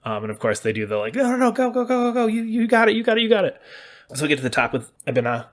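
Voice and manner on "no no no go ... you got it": much higher, louder urging, a rapid string of encouragement; excited cheering. Retold or imitated. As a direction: cheer a friend on, over and over. Allies cheering you on.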